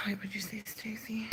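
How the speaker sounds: whispered aside to herself. Real, not acted. Streamer whispering while reading a chat message. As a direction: breathe it out, unvoiced.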